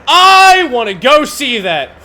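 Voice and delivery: drawn-out eager yes; eager sudden agreement. Retold or imitated. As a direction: flip to gushing enthusiasm to please her. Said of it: "And I went".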